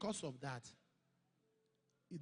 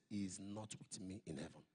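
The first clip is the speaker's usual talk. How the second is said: the Lord speaking; 11 semitones lower, 4 dB quieter than usual. Retold or imitated.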